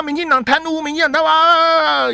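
hammed-up host bashing the party on air; mock ranting host. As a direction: parody a partisan talk-show host. Parodying partisan show host.

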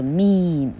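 meme with a long drawn-out "ee"; long vowel. Shown as a demonstration. Teacher summing up the rule, meme with a long vowel. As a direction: stretch meme into one long syllable, final e silent. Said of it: "所以迷音是念作meme".

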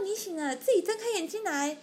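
high, coaxing nurse voice; a lightly voiced nurse nudging a patient to open her eyes; nurse urging. 护士阿姨叫病人睁眼. (高音催促病人睁眼的护士)

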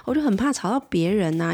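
ordinary talk, nothing marked

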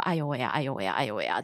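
quiet rehearsed chant under the breath; 哎呦喂啊 murmured over and over, low and quiet; muttered to oneself. Retold or imitated. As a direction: repeat it softly to yourself like a mantra. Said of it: "一直在心里面默念".

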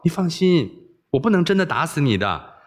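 ordinary delivery

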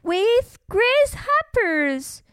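high, slow, relishing; gleeful shout. A narrator's reading. Joe adds to bug pie invitation, gleeful. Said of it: "Joe shouted gleefully".

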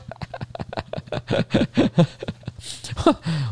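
soft voiceless laughter fading away; long silent laugh. Real, not acted. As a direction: let the laugh run out quietly. Laughing at staff's teasing.

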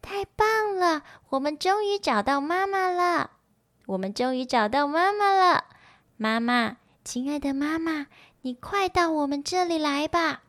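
high-pitched, happy little tadpole voice; joyful tadpoles. A narrator's reading. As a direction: cheer for mama in a high, childlike pitch. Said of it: "高兴地在水里翻起跟斗来".

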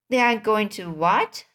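usual speaking voice

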